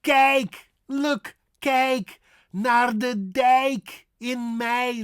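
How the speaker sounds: high, stretched ei in Kijk, dijk and May; exaggerated Dutch vowels on kijk and dijk; slow Dutch model. Shown as a demonstration. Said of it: "Not mooi, not dike".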